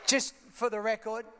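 usual speaking voice

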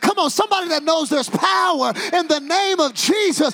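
loud, high, sing-song preaching; whooping preacher at full volume; full-force preaching. Acted. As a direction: drive the crowd to praise.

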